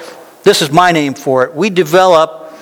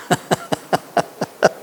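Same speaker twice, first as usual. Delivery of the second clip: soft laugh; 3 semitones higher, 8 dB quieter than usual. Real, not acted. Laugh after stop what ifing.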